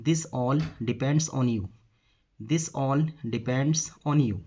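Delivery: slow, word by word; measured dictation-style delivery; slow model sentence. Shown as a demonstration. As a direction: say it slowly for learners, spacing each word. Teacher models the English sentence.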